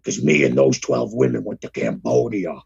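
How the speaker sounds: very low, gravelly-free deep rumble; Jesse Ventura impression. Playing Jesse Ventura for cohost, very low voice. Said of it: "advertisement for the episode as Jesse the Body".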